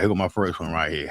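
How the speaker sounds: ordinary talk, nothing marked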